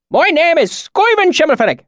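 put-on high, loud rustic swineherd accent; thick valley accent. A narrator's reading. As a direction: speak up in broad backwoods dialect. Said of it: "his accent so thick and oily".

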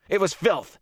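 high, agitated disgust; vehement disgust. Acted. Rand explains himself to silent watchers, in vehement disgust. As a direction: spit it out in revulsion.

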